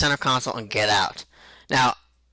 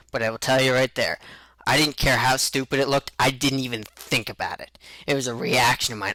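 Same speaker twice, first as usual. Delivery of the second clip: ordinary talk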